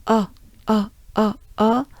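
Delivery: O round and closed; French o. Shown as a demonstration. Teacher drilling the o of Rosheim.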